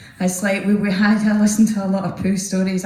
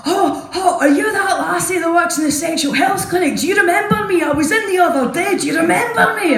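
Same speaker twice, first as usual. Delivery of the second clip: put-on excited drunk lad; 8 semitones higher than usual. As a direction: gush at her like an eager young fan. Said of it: "comes up to me like".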